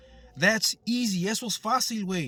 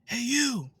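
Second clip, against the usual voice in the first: mock yell, raised and higher; retold scream; 5 semitones higher than usual. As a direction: imitate a woman hollering at you. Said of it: "She screamed at me and said".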